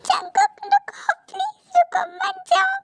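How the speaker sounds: high, drawn-out tearful begging; slow, heartbroken high wail; crying plea. Acted. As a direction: call after a departing lover through sobs. She begs him not to leave.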